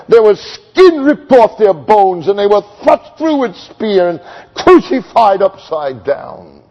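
loud, rising sermon climax; impassioned pulpit proclamation, pitched high and loud; full-force preaching. Acted. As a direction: preach it out, building to a roar.

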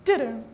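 low two-note beep noise; short, low vocal imitation of a phone alert; imitated phone beep. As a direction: mimic a dying mobile's beep. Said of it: "it went like this".

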